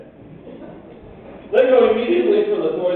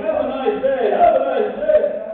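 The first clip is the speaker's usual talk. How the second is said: mocking repeat; 6 semitones higher, 5 dB louder, slower than usual. Mocking repeat of clerk's goodbye.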